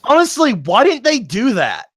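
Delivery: high, loud incredulous whine; exasperated, swooping high and loud. Real, not acted. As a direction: question it in high-pitched disbelief. Exasperated questioning of earlier characters' choice.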